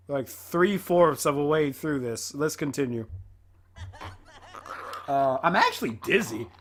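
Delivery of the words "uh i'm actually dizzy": breathless laugh. Real, not acted. After laughing fit.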